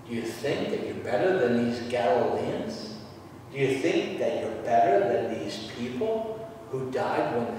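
soft, low, pointed questioning; hushed, probing repetition; quiet challenge. Acted. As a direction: press the question gently, lifting at the end. Acting Jesus' pointed questions.

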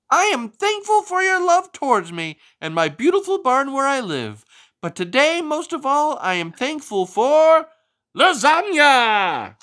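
a proud little turkey giving thanks. A narrator's reading. Turkey Wobble thanks the farmers at the feast, high-pitched.

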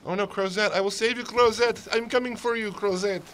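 loud, high hero cry; hammed-up rescue call; heroic shout. Hammy rescue of game character.

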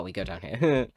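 usual speaking voice

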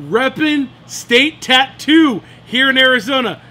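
announcer-style plug for the tattoo shop; hyped shout-out. Real, not acted. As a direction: sell the shout-out like a hype man.

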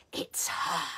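whispered hiss, It's her breathed out. Acted. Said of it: "hissed the witches".

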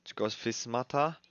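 ordinary delivery

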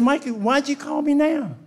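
high loud mock exasperation; mock shout. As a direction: yell it in comic disbelief.

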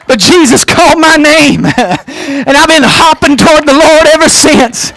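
high, emphatic pulpit delivery. Real, not acted.

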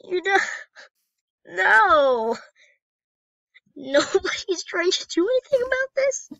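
high, loud, indignant outcry; hammed-up despair at everyone standing by; distraught protest. Player protesting nobody's doing anything for Jacob, distraught outcry.

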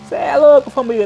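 loud, high burst of hype; excited shout. Real, not acted. Motovlogger thrilled by the exhaust roar, loud excited yell. Exhaust roaring, hyped to viewers.

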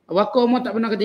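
ordinary talk, nothing marked

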